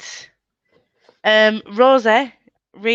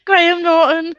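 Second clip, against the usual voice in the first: put-on Irish accent; 10 semitones higher, 6 dB louder than usual. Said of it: "I watch Graham Norton".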